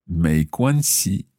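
slow, careful learner Mandarin; careful Chinese accent. Acted. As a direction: speak each syllable slowly, role-playing. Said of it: "my best Chinese accent".

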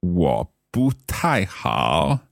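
careful learner echo of 我不太好; slow learner repeat. Shown as a demonstration. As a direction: slowly repeat 我不太好 after the teacher, syllable by syllable.

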